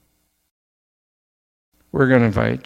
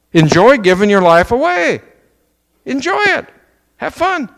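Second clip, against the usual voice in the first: much higher, lifting up on each phrase; bright exhortation; 10 semitones higher, 3 dB louder than usual. Real, not acted. Preacher urging the church to give their lives away, high and buoyant. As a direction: sing it out encouragingly.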